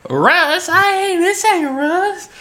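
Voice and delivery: Russ impression. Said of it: "You're fading. You're becoming him".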